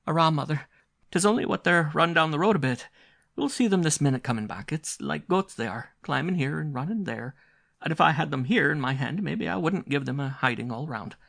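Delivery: put-on high, loud, brisk Irish girl brushing off worry; girl waving off her mother's fears; Nell, brisk young girl. A narrator's reading. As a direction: reassure the mother a bit impatiently. Nell voiced in the story.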